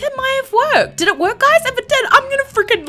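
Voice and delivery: thrilled excitement. Real, not acted. Streamer telling viewers the lag might be fixed, thrilled. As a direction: squeal with rising excitement. Elated that lag fix worked.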